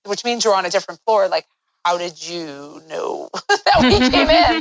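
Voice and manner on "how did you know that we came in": slow, incredulous question broken by a laugh; laughing in disbelief. Retold or imitated. Retelling the stranger at the hospital, laughing in disbelief. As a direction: laugh through the question at how strange it was. Recounting a bizarre stranger encounter.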